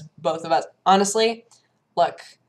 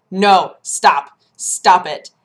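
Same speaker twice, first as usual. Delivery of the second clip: usual speaking voice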